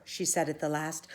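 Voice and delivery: ordinary delivery, nothing marked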